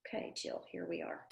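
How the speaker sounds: whispered aside to herself. Real, not acted. Coach whispering to herself while waiting for Jill. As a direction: breathe it under her voice.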